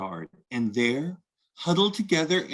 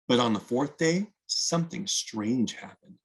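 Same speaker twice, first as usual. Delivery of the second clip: ordinary delivery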